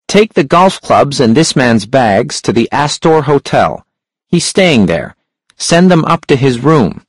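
a sharp, high-pitched hunchback. A narrator's reading. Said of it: "The hunchback's voice was sharp and high".

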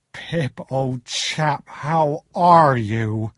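very slow, drawn-out country greeting; hearty old friend. A narrator's reading.

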